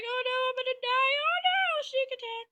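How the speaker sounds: squeaky panic. Retold or imitated. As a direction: squeal it in tiny-soldier fright. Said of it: "she's like".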